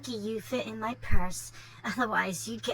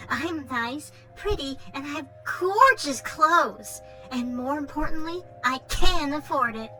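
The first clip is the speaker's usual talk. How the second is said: put-on spoiled Valley Girl brat; Valley Girl accent. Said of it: "Valley Girl accent going".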